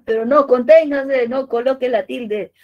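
loud, high comic plea for restraint; mock stern warning. Teacher telling students to resist adding the accent, loud mock alarm. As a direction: cry out as if stopping someone giving in.

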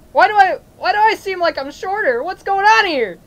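loud, high, freaked-out cries; alarmed panic. Acted. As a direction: panic loudly at what is happening to you. Panic at finding himself changed.

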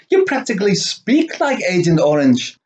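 ordinary talk, nothing marked

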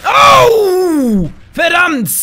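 sudden loud, high yelp of surprise; startled shout. Real, not acted. Gamer jolted by a sudden enemy, startled shout. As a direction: jolt out a startled cry.

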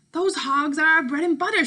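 mother defending the farm; hint of a distressed mother; worried mother. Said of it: "she said".

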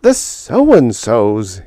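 plain reading voice

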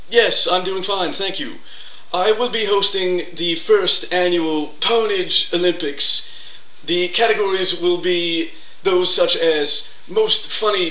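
Coughlin impression. Parodying a rival YouTuber, high pompous host voice. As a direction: announce yourself like a self-important YouTuber. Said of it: "here's my impression. Coughlin".

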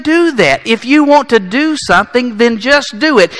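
loud, high, slow mocking call; a preacher hamming up carefree living; mock party cheer. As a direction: whip them up sarcastically. Preacher mocking live-it-up attitude.

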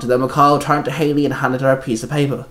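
ordinary delivery

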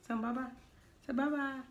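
high, soft bye-bye; baby-talk goodbye. Pretend. Coaxing the partner to wave goodbye, soft baby talk. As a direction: coo it like to a child. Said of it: "coaxing a goodbye".

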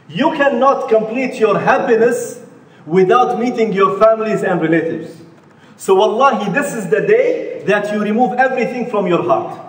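usual speaking voice

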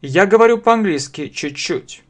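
ordinary talk, nothing marked

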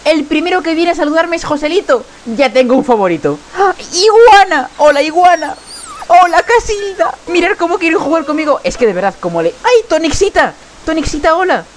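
doting high cooing; cooing to babies. Real, not acted. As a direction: greet each pup like a doting parent. Greeting the wolf pups lovingly.

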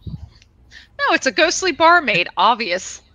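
high, loud playful objection; mock protest. Player cheekily corrects game master about the ghostly figure, mock protest. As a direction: object in an exaggerated, high-pitched voice.

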